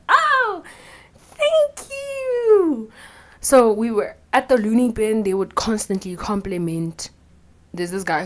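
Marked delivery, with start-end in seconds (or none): 0.1-2.9 squealing "Oh, thank you." way up high in delight; overjoyed squeal. Retold or imitated. Thanking someone who likes her outfit, a delighted squeal. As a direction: gush like the compliment made your day. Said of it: "I get so happy".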